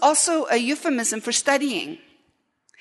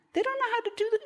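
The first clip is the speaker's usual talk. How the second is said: mocking villager; 12 semitones higher, 6 dB quieter, much faster than usual. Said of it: "they go".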